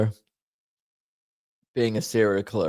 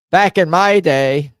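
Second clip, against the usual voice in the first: mock old-timer; 8 semitones higher, 9 dB louder, slower than usual.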